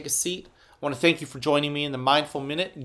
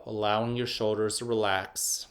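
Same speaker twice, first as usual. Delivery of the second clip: guided meditation; 4 semitones lower, 4 dB quieter than usual. Acted. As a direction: ease the kids into calm, one breath at a time. Leads a mindful minute.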